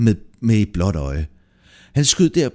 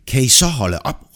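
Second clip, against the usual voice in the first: raised, higher voice; exasperated yell, moderately raised; annoyed shout; 4 semitones higher, 5 dB louder than usual. A narrator's reading. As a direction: call out for them to stop, louder. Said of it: "råbte Bror Lystig".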